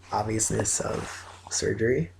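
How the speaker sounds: ordinary delivery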